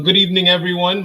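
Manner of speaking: normal talking voice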